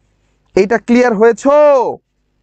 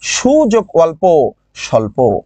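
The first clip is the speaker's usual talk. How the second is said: slow dictation; 4 semitones lower, slower than usual. Shown as a demonstration. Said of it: "যেমন".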